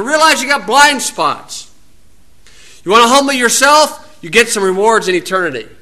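fiery pulpit delivery; full-force preaching. Acted. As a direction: preach it hard to the congregation.

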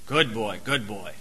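soft sweet dog talk; sweet praise tone. Shown as a demonstration. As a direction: coax the dog sweetly whatever the words. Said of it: "Animals operate by tones".

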